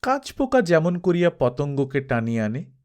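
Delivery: plain reading voice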